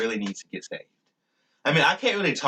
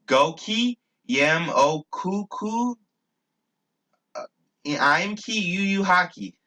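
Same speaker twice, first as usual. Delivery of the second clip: very slow, raised pitch, word by word; careful repetition; 6 semitones higher, slower than usual. Shown as a demonstration. Speaker repeats his made-up phrase for viewers, slow and careful. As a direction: stretch out each made-up word as if teaching it.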